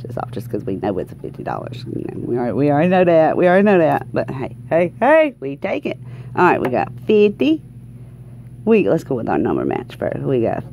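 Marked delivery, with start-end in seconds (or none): none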